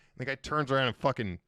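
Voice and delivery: normal talking voice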